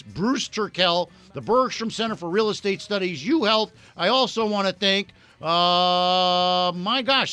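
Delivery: loud radio host reeling off sponsors; booming sign-off thanks, name after name; sponsor shout-out read. Acted. Radio host thanking all his sponsors, loudly.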